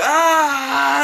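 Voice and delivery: high loud groaning yell; acted sympathetic agony; re-enacted cry of pain. Re-enacting own reaction.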